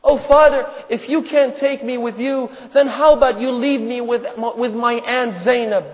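impassioned acted plea; pleading daughter. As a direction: beg father as his little girl, preacher's acting. Daughter begging her father in story.

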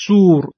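سور as "soor" with a long "oo"; long rounded "oo" in سور; long vav. Shown as a demonstration. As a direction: say سور with a drawn, close "oo". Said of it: "سور هم کشیده هست".

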